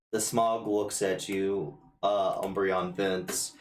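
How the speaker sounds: ordinary talk, nothing marked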